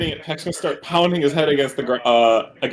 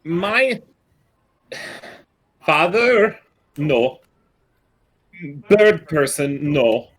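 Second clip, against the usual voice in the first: halting mimicked voices; 5 semitones higher, much slower than usual. Mimic bird answers in borrowed words.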